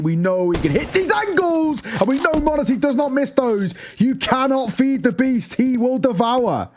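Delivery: high hyped-up call; excited. Real, not acted. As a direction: build the hype for the star. Caster hyping the star player.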